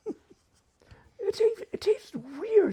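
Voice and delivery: put-on high, slow, drawn-out child's complaint; little girl voice. Cousin Polly tasting the liver, in a high slow kid voice. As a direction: play the seven-year-old wrinkling her nose.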